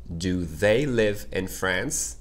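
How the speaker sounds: very slow, word-by-word teacher demo; deliberate, drawn-out language-lesson delivery; slow model sentence. Shown as a demonstration. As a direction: space out each word for learners to repeat.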